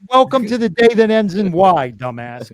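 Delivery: loud, high teasing welcome; mock welcome. Real, not acted. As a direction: rib the stoned guest loudly. Mock greeting to stoned guest.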